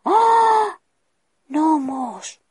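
high, loud gasp of discovery; surprised exclamation. Acted. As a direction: burst out at the sight of gnomes. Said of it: "exclamó".

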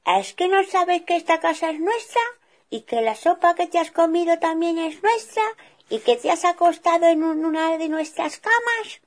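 high, loud scolding bear; father bear scolding the girl; loud reproach, high; indignant father bear. Acted. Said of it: "exclamó papá oso".

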